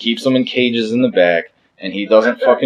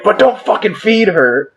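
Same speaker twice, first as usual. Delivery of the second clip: high-pitched mock yell, no real volume; retold heckling yell; 9 semitones higher than usual. Audience member heckling the singer about merch girl, imitated yell. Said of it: "I yelled from the audience".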